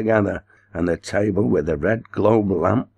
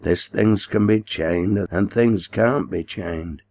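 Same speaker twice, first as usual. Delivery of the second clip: ordinary delivery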